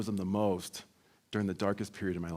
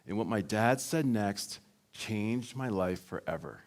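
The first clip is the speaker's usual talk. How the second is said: normal talking voice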